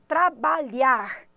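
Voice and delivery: trabalhar drawn out slowly with the ar ending stressed. Shown as a demonstration. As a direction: lean on the ar at the end of trabalhar. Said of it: "Termina em ar".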